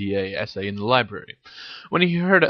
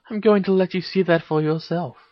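higher, innocent-sounding evasion; 8 semitones higher than usual. A narrator's reading. Hermione keeps Harry guessing, innocent. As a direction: withhold the answer innocently. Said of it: "blinked Hermione innocently".

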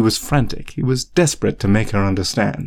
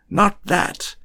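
a high, sharp outburst; pitched-up protesting cry; cried out; 11 semitones higher than usual. A narrator's reading. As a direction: burst out in protest. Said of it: "he cried".

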